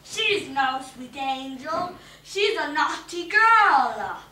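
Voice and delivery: slow, loud scolding; the witch scolding Manuela. A narrator's reading.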